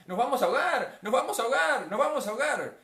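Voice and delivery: high, loud repeated cries; panicked shouting. Acted. Disciples crying out they will drown, panicked. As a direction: shout it over and over in acted panic. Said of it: "empezaron a gritar".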